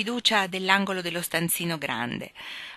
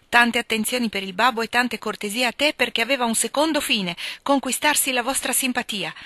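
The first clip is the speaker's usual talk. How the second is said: higher and louder, rising indignation; indignant outburst; 5 semitones higher, 5 dB louder than usual. Acted. Joe protesting to her mother about Meg's suitor, indignant and loud. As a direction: let Joe's protest boil over, pitch climbing. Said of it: "Non ti arrabbiare".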